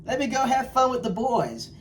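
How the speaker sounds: mock excited voice for going out with the boys; a hammed-up imitation of someone giving in to fun; tempted friend. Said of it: "And you're like".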